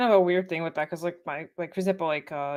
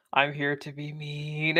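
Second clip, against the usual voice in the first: lower, softer, slow ghostly drone; mock ghost voice; 4 semitones lower, 6 dB quieter, much slower than usual. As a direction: moan it like a ghost. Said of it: "he's just like".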